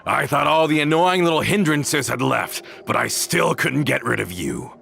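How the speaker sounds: sneering taunt. Acted. Villain sneering at the heroes.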